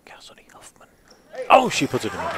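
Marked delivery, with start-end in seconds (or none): 1.5-2.3 hushed British golf commentator; golf announcer voice. Pretend. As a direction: whisper it like a golf broadcast. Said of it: "talk like a golf announcer".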